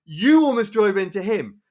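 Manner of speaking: high, drawn-out "Oi, no"; indignant challenge. Retold or imitated. Onlooker calls out the driver who almost drove into him, indignant. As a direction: call him out, pitch jumping up. Confronting the driver in the retold row.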